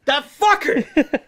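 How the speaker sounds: high, loud, slow indignant cry; mock outrage. Host playing his boss catching him, mock outraged. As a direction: drag it out like a betrayed boss.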